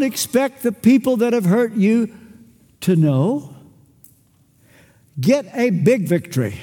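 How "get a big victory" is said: normal talking voice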